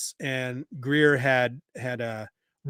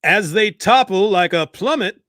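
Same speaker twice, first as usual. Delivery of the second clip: dramatic vintage radio announcer, louder; old-timey radio guy; 4 semitones higher, 7 dB louder than usual. Acted. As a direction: boom it out like a 1940s serial narrator. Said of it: "old-timey radio guy".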